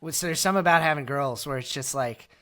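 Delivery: normal talking voice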